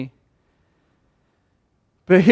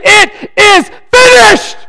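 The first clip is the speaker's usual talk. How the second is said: slow, high, loud cry of victory; triumphant shout; 12 dB louder, much slower than usual. Retold or imitated. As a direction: shout it out as the last word from the cross. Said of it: "he said".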